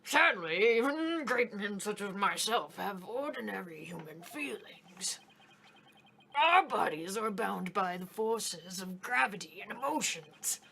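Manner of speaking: put-on whispery pompous old man; grand old man, hushed and self-important; Daddy Grandpa voice. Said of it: "I gotta get into Daddy Grandpa voice".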